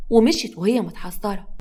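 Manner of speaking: plain reading voice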